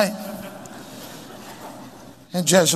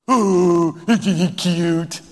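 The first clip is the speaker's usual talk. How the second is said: slow, cooing gush imitation; mock baby talk; much slower than usual. Parent admiring a boy with his blankie, mock cooing. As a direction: coo drawn-out over a cute little one. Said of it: "Why are you talking like that?".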